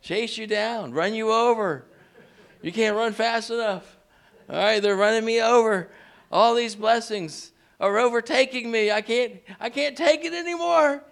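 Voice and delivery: high, loud and rising preacher's call; building sermon climax, calling out to the congregation; fired-up preaching. Acted. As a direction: lift the church with every phrase. Preaching at full force.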